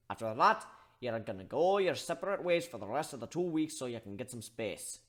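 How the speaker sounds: put-on loud, firm orders to the kids; gruff mediator. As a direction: boss them into making up. Steelhorn voiced ordering the hugs.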